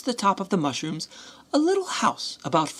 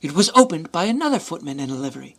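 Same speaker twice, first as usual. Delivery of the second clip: normal talking voice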